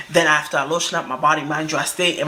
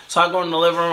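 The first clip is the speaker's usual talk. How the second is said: usual speaking voice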